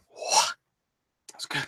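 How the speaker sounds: slow, voiceless whisper; awed whisper. Real, not acted. Fan overwhelmed by the kiss, awed whisper. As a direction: breathe it out very slowly, barely voiced.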